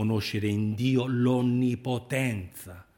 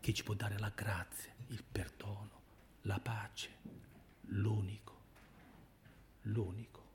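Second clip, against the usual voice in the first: very soft, almost whispered; hushed, spaced-out preacher's close; hushed reverence; 16 dB quieter than usual. Real, not acted. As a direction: let it sink into a near whisper. Sermon point fades into hushed repetition.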